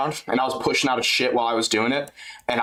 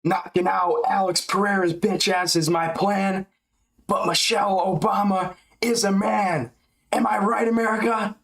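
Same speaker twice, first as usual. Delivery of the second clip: higher, hammed-up Hulk rapping; Hulk hype voice; 6 semitones higher than usual. Hyping the crowd in a corny Hulk voice. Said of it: "Make up some corny ass Incredible Hulk rhymes".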